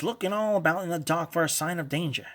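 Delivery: ordinary delivery, nothing marked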